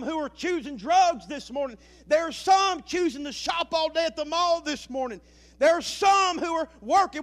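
loud, high, fervent preaching; preaching at full force. Real, not acted. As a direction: pour it all out to the church. Preaching to the congregation.

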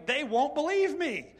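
high, loud, slow pleading; anxious protest. Retold or imitated. Moses objecting to God, high and loud. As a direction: plead that nobody will listen. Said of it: "He went on to say".

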